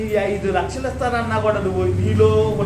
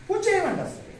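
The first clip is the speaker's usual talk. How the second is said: mocking voice; 11 semitones higher, 4 dB quieter than usual. Mocking worshipper's flippant reply.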